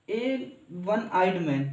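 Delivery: each word drawn out very slowly; teacher slowing down so students can follow; slow dictation. Shown as a demonstration. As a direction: read it out slowly for the class. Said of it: "लेकिन उच्चारण देखो".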